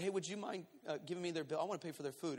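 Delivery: low-volume, hushed asking; discreet request. Acted. Diner asking waitress for another table's bill, hushed. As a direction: ask it softly so others miss it.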